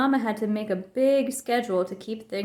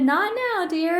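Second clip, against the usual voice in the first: high loud shout; Mama shouting at Papa while rushing off; 8 semitones higher, 5 dB louder, slower than usual. A narrator's reading. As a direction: snap it out loud, higher. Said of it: "Mama shouted".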